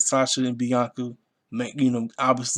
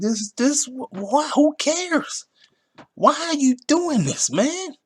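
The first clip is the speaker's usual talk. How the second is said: much higher, strained whining complaint; exasperated high whine; 14 semitones higher, much slower than usual. Retold or imitated. Vlogger retelling his reaction to the booking, whining high. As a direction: pitch way up in mock despair.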